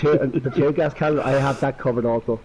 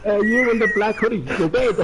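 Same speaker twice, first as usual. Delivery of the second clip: mock loudspeaker; 7 semitones higher than usual. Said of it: "grabbing the loudspeaker shouting".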